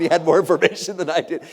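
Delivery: ordinary talk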